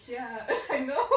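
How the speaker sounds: knowing laugh through the words; laughing along. Retold or imitated. Agreeing with the other person, laughing. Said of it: "And you're like".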